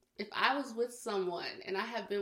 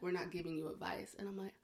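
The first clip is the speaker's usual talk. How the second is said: whispering; 8 dB quieter than usual. Retold or imitated. Whispered retelling of listeners.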